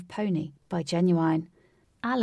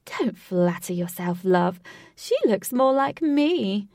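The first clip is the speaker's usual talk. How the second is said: growling, cooing woman; 5 dB louder, slower than usual. Acted. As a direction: purr it smugly at her rival. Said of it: "said the growling and cooing woman".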